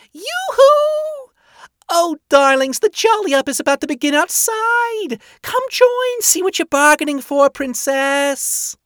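very high, loud singsong hostess calling from below; Rosie calling out. A narrator's reading. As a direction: trill a loud, high summons to distant guests. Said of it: "Rosie's voice calling their names sounded".

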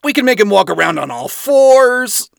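loud, high, cocky voice; brash man voice. Acted. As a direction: egg her on with big bravado. Adam voiced in story.

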